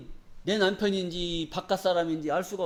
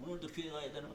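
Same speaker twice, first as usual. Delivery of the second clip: reluctant churchgoer; 5 semitones lower, 19 dB quieter, faster than usual. Retold or imitated.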